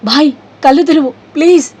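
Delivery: panicked shout at his unconscious brother; terrified scream. A narrator's reading. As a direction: scream at him to wake up. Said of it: "భయంతో గట్టిగా అరిచాడు".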